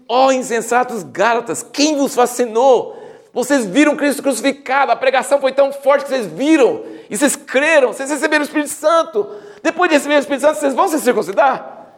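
high, insistent acted scolding; impassioned rebuke. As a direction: scold them as an exasperated apostle would. Acts out Paul rebuking the Galatians.

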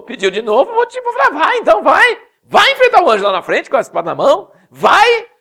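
high, loud mock exasperation; exasperated God. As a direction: wave him off, laughing. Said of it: "Deus falou assim". (Deus exasperado, voz aguda e alta)

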